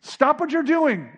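high, firm command; rebuke. Acted. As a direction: order the wind to stop. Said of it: "It was a rebuke".

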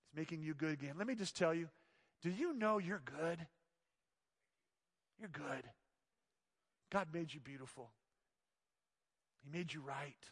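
soft, low, gentle reassurance; tender reassurance. Real, not acted. Pastor softly assuring the congregation they are good. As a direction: speak it softly to each listener.

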